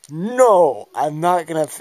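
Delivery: hammed-up loud refusal; mock protest. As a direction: cry out a big playful "no". Acted battle protest.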